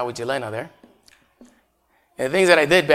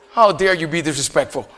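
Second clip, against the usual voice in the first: mock stern teacher; 4 dB louder than usual. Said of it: "the teachers used to tell me".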